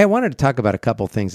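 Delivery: ordinary talk, nothing marked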